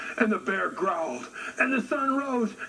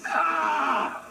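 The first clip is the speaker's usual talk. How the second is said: frustrated shout; 10 semitones higher than usual. Acted. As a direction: bellow it out in frustration. Bear cries out in frustration.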